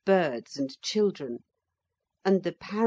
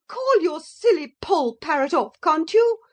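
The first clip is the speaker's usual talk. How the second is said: very high, loud indignant cry; shrill complaint; 12 semitones higher, 8 dB louder than usual. A narrator's reading. Motor lady to the children, high indignant cry. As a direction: demand they call it off.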